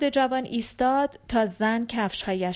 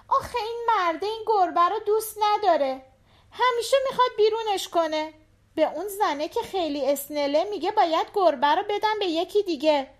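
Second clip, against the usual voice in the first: put-on high, quick little-girl voice; a young girl eagerly explaining to her dad, pitched high; little daughter; 11 semitones higher, faster than usual. A narrator's reading. (صدای زیر و تند دختربچه)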